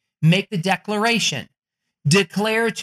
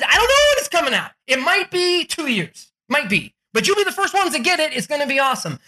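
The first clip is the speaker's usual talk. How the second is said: higher, breathless sales pitch; hyped-up Elon Musk; 8 semitones higher than usual. Retold or imitated. Elon Musk promising buyers an unbuilt car, hyped and high. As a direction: sell the dream with giddy confidence. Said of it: "And he said".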